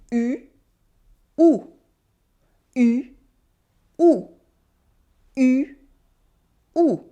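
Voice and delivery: "U" switching between pursed-lip "ee" and back "oo"; contrasting U sounds. Shown as a demonstration. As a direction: alternate "U" as French front rounded vowel and as "oo". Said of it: "Can you make the difference?".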